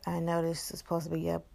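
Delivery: ordinary talk, nothing marked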